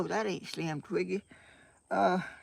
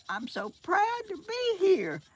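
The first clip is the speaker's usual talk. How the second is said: proud; 11 semitones higher than usual. Real, not acted. Proud of getting on live.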